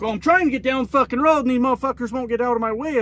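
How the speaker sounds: high-pitched imitated road-rage complaint; dad losing it. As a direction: imitate an exasperated dad venting at traffic. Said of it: "he's losing his shit out there".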